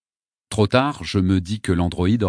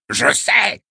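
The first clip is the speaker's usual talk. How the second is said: put-on high, loud old man's yelp; 7 semitones higher, 4 dB louder than usual. A narrator's reading. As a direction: snap it out in a shrill, impatient yelp. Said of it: "glapit-il".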